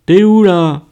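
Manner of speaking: loud, high foreman's yell; shouting. A narrator's reading. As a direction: bark it over the machines. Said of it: "hurla le chef d'équipe".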